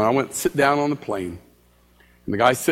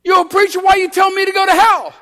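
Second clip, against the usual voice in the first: high, louder accusing challenge; indignant; 6 dB louder, faster than usual. Retold or imitated. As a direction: demand an answer, offended. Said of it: "He said".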